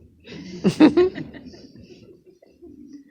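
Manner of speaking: loud, high burst of laughter; big laugh. Real, not acted. Eulogy speaker laughing at his friend's teasing, loud high burst of laughter. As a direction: let out a big open laugh. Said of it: "I responded poorly".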